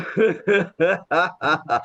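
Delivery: rhythmic demo laugh after a nose breath; deliberate, evenly pulsed exercise laugh; laughter yoga laugh. Shown as a demonstration. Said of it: "नाक से सांस लेते हैं".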